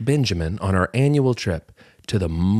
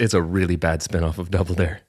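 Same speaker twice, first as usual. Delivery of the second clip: normal talking voice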